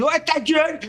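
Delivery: cri des détenus. Retold or imitated. Détenus crient de leurs cellules.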